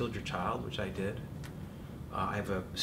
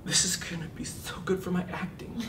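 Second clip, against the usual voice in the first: awed whisper; 8 semitones higher than usual. Retold or imitated. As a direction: hush it in disbelief. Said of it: "and she said".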